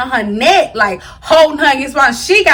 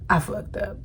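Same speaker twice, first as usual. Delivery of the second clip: breathless whisper, much softer; whispered admission; 7 semitones lower, 14 dB quieter than usual. Real, not acted. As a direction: whisper it like a guilty confession. Admits mistake in a hush.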